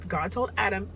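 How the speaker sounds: ordinary delivery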